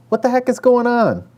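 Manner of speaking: high, loud call from another room; husband calling out from the kitchen, baffled. A narrator's reading. Husband shouting from the kitchen at the kids, alarmed call. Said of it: "he called out from the kitchen".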